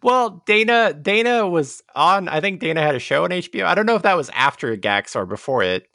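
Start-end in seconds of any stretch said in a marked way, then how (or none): none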